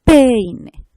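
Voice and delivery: "peine" as a loud PEI-ne, stress on the E; stress on first syllable. Shown as a demonstration. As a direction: hit the PEI of "peine" hard, then drop on -ne.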